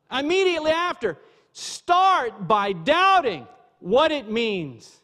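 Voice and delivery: high, slow, drawn-out preaching; emphatic preaching plea. Real, not acted. Preacher urging listeners to doubt first, high drawn-out plea. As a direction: plead from the pulpit, stretching key words high.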